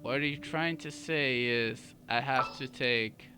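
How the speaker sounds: ordinary delivery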